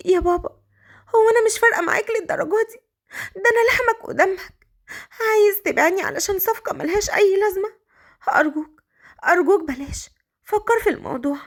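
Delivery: high-pitched crying plea; acted weepy, wounded pleading; tearful. A narrator's reading. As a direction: beg your father through tears. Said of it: "بصت له مليكة بدموع وقالت".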